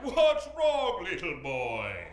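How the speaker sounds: hammed-up department-store Santa; put-on Santa Claus. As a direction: play a school-age Santa addressing a child. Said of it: "you're gonna be Santa Claus".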